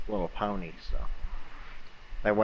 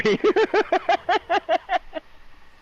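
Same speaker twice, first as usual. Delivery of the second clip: high, loud cackle; laughing; 5 dB louder than usual. Real, not acted. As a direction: burst out cackling at a silly joke. Weenie joke in the story.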